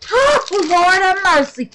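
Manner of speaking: high, loud exclamation; rapturous. Real, not acted. Smelling her soap, rapturous. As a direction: swoon over the smell.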